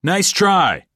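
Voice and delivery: a touch of a cheering shout; shouted encouragement. A narrator's reading. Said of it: "so I shouted".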